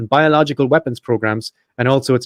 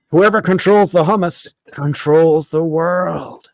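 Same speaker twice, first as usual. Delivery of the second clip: slow, higher dramatic proclamation; mock ominous slogan; 4 semitones higher, much slower than usual. Host ends his fake hummus article, mock ominous slogan. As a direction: draw it out like a grand villainous tagline.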